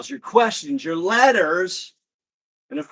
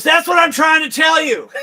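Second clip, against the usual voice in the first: high, loud punchline outburst; exasperated shout; 10 semitones higher, 8 dB louder than usual. Acted. Clerk loses it at a persistent customer, exasperated shout. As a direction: act the clerk finally exploding.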